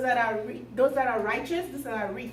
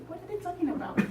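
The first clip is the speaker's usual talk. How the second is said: put-on softer, higher puzzled child; lightly voiced little boy; 6 semitones higher, 5 dB quieter, faster than usual. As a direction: wonder quietly like a small boy. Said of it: "there was a little boy".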